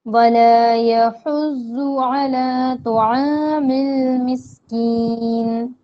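slow, drawn-out Quranic chant; Quran recitation. Shown as a demonstration. Teacher reciting the verse for students, slow chant. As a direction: recite each word slowly, holding the long vowels.

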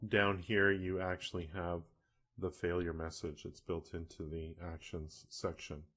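usual speaking voice

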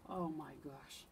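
ordinary delivery

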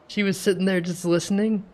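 ordinary talk

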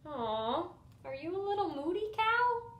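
put-on high, cooing baby talk; a tender tease; cooing teasing mom. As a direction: coo it at a sulking child. Mom cooing at sad son.

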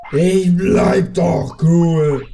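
loud, slow indignant yell; indignant shout. Real, not acted. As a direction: shout back that you're calm. Answers game hint angrily.

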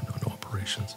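breathless whispered no to surgery; whispered refusal. Retold or imitated. Father refusing a brain operation.

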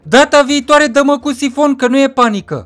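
high, loud character voice; bantering friend. Acted. Friend bantering back in story.

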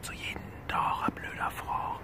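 hushed whisper so nobody wakes; whispering on the phone. Real, not acted. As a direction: whisper softly, others are asleep. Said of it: "Ich muss leise sein".

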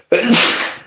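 sudden cough; a single real cough. Real, not acted. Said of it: "it's coming".

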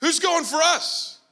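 slow, eager call to the army; David calling out for a hero; eager challenge. Retold or imitated. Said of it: "And David's like".

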